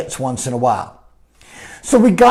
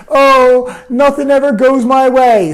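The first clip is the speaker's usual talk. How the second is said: mock woe-is-me lament, pitched up and louder; victim complaint; 6 semitones higher, 3 dB louder than usual. Coach mimicking typical victimization language, mock victim complaint. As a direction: play the self-pitying victim. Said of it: "victimization language, like".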